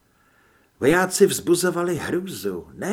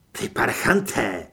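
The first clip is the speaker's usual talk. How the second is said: louder, growled insult; angry growl; 4 dB louder than usual. A narrator's reading. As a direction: growl it out in anger. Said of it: "Zavrčel a udělal krok vpřed". (vzteklé zavrčení)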